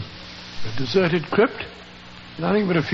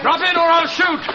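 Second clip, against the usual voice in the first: threatening shout at a fleeing thief; 9 semitones higher than usual. Acted. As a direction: bark the threat across the tarn. Threatening Devers at the tarn.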